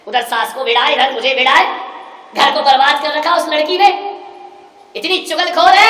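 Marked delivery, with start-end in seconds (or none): 2.3-5.9 loud, high accusation; loud, spiteful daughter; angry gossip. Retold or imitated. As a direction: rail against the sister-in-law. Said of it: "अरे बोले".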